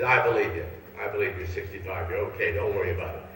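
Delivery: low, soothing reassurance. Acted. Clerk reassuring the old man, low and gentle. Clerk convinced by gray hair.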